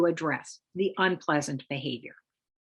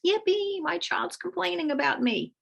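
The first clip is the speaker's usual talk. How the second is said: much higher, mock delighted cheer; sarcastic hammed-up yippee; mock glee; 10 semitones higher than usual. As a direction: fake joy, clearly ironic. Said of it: "No one really thinks".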